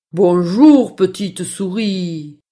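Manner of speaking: low, slow, howling wolf greeting; storybook wolf. Acted. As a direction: draw out hello as a wolf. (voix grave et lente de loup)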